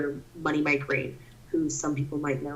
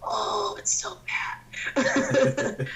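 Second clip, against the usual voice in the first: laughing whisper; much slower than usual. Retold or imitated. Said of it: "I'm just like".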